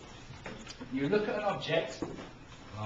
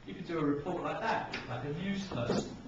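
ordinary delivery

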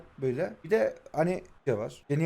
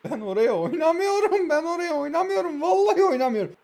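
much higher, whining denial; high-pitched protest; 9 semitones higher than usual. Real, not acted. Player swearing he didn't pass there, high-pitched protest.

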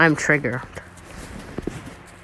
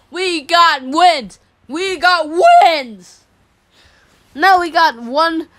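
gloating; 7 semitones higher, slower than usual. Real, not acted. Gloating drawn-out celebration.

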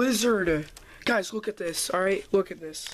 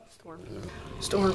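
ordinary delivery

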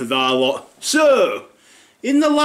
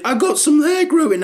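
high chirpy boast; proud kid voice; 12 semitones higher than usual. Retold or imitated. Son proudly telling dad about his hair. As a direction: show it off like a proud young lad.

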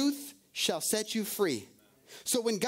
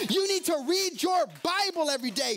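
shouted preaching; 6 semitones higher than usual. Acted. Preacher shouting duties at the congregation, forceful. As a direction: shout each command to the church.

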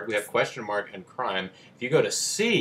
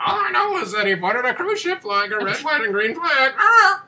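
shrill high squawking parrot; 8 dB louder than usual. Acted.

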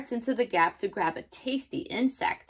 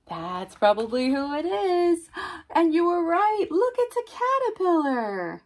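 high, louder, building delight; excited reveal; 9 semitones higher, 5 dB louder than usual. Acted. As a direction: cheer the young guesser with a big gasp. Revealing the guessed animal.

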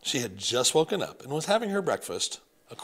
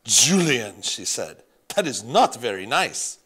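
put-on louder gentle scolding, French accent; reproving French accent; 8 dB louder than usual. Grandmother scolding grandson, French accent. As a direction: chide him like a French grandmother. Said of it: "Grandmere had a thick French accent".

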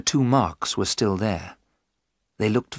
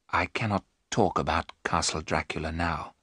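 low, soft, haunted murmur; hushed whisper; 6 semitones lower, 6 dB quieter than usual. A narrator's reading. Jonathan, ill, refuses to talk of the castle, hushed. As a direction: lower your voice almost to a whisper. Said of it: "Jonathan whispered".

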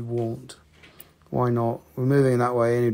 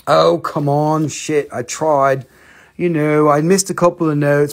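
real annoyance at botching the notes; frustrated outburst; 2 semitones higher, 7 dB louder than usual. Real, not acted. Streamer frustrated after missing notes, louder exasperated cry. Flubbed song, frustrated at himself.

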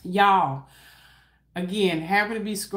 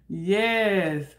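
ordinary delivery

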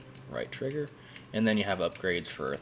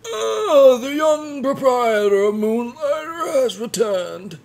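put-on much higher, louder old man voice; 12 dB louder, slower than usual. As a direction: play the wise old shopkeeper. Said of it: "Now I gotta do the old man voice again".

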